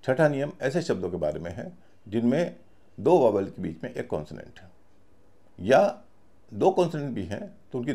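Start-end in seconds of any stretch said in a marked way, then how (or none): none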